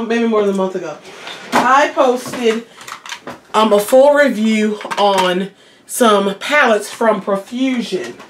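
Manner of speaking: ordinary talk, nothing marked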